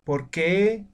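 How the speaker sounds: POR QUÉ climbing high on QUÉ; rising question intonation. Shown as a demonstration. Teacher shows Castilian question marks being voiced, rising tone. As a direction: lift QUÉ at the end like a Spanish question. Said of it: "entonamos al, al terminar".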